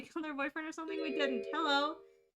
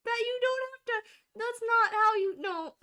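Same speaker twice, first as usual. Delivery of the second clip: exasperated; 10 semitones higher, 8 dB louder than usual. Real, not acted. Player correcting the game's Japanese.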